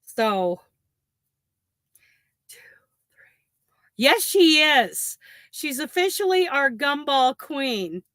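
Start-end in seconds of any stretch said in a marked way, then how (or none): 2.5-3.9 counting stitches in a whisper. Real, not acted. Crocheter counts her chain stitches under her breath, whispering. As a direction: breathe the numbers to yourself.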